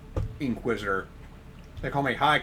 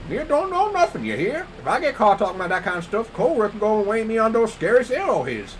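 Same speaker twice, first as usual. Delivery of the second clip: high farmhand voice; 8 semitones higher, 5 dB louder than usual. Acted. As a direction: squeak it out like a jumpy farmhand. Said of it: "Why are you talking like that?".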